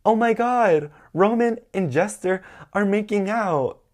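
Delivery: high, loud mock yell; imitating party-goers yelling up high; scandalized crowd shout. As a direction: call out the gossip like a shocked onlooker. Crowd yelling gossip from below.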